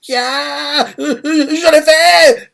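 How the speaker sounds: put-on a kid yelling out that he did it; high cry of triumph. Ten shouting to Doppo, a high boyish slow triumphant cry. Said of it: "il crie comme ça".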